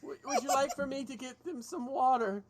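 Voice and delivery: high, hesitant offer; nervous bar hand. Acted. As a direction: stammer slightly, eager to be helpful. Game master speaks as bar hand.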